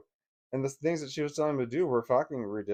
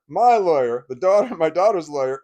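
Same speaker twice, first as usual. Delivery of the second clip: normal talking voice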